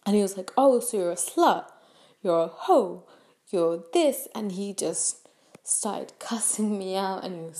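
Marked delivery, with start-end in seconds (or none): 0.6-4.3 imitating an accusing outburst, punched louder; mock angry insults. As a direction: throw out mock insults, one by one. Acts out his insults in quarrel.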